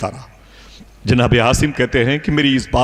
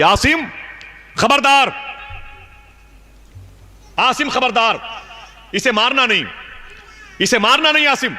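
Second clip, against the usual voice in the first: high, ringing voice from nowhere; mysterious warning voice; 13 semitones higher than usual. Retold or imitated. Unseen voice warning Asim not to kill his daughter, high ringing warning. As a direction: call out a sharp warning from beyond. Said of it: "پھر آواز آئی".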